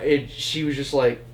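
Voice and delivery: normal talking voice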